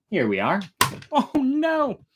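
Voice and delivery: ordinary delivery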